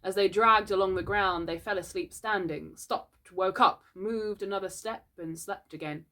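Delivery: usual speaking voice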